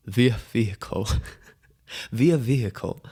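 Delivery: "via vehicle" savoured, breaking into a laugh; laughing repeat. Shown as a demonstration. Host amused by his own phrase, laughing. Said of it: "Too funny".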